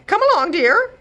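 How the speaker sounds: high, loud hurried reply; hurried brush-off. Acted. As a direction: wave her off in a rush. Godmother urging husband away.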